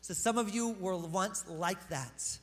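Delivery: slow, deliberate scripture read; slow, weighty reading of the verse; slow verse reread. Shown as a demonstration. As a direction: lay each word down slowly. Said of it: "so I could get to verse eleven".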